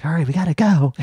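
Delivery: high, loud fake alarm; mock panic. Faking panic to start shoppers panic buying, in a loud alarmed call. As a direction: yell it to spark hysteria in the store. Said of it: "be like".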